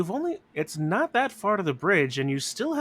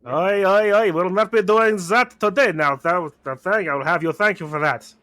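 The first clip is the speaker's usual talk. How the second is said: loud, higher scolding Cockney-ish vampire; lightly voiced rival vampire, scolding; distinctive vampire accent; 4 semitones higher, 8 dB louder than usual. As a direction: tell them off as the other vampire. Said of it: "another vampire out here with a very distinctive accent".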